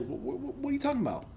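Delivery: high, stammering, incredulous question; stunned disbelief. Retold or imitated. Retold shock at Jody comment.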